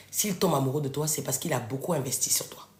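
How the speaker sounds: confiding whisper. Real, not acted. Confiding a secret to her followers, whispered. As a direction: drop to a whisper, let them lean in. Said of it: "confiding a key point".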